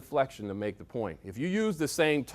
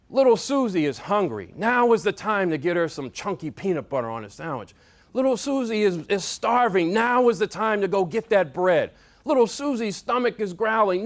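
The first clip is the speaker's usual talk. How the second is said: raised, higher-pitched motivational speech; rallying cry; 6 semitones higher, 5 dB louder than usual. Shown as a demonstration. Speaker rallying people to feed little Susie, building rallying cry. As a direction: drive the repeated refrain home, building in force.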